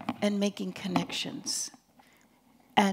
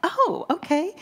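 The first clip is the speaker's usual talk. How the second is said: surprised reply; 9 semitones higher, 6 dB louder than usual. Retold or imitated. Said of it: "And I'm like".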